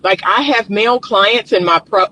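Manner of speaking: normal talking voice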